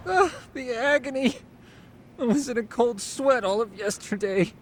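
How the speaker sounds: high-pitched theatrical groan of complaint; mock agony. Injured friend complaining to the group: hammed-up whining. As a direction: whine about the pain, hamming it up. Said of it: "got the energy to complain".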